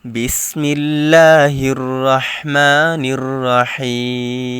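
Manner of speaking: slow, loud, melodic tajweed chant; Quran recitation. Shown as a demonstration. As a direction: recite it drawn out like in the mosque. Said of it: "teacher recites opening formulas". (ধীর, উচ্চ স্বরে সুরেলা তিলাওয়াত)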